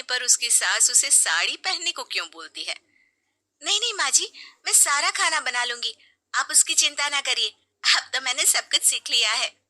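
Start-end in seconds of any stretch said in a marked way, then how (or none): 3.6-9.6 much higher, keen offer; eager bride. Acted. Sheena offering to cook for her mother-in-law, eager. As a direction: insist brightly she'll cook.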